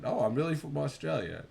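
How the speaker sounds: high, loud mock Australian accent; put-on Australian accent. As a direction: insist on being Australian in a fake accent. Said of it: "he actually believed me for a second".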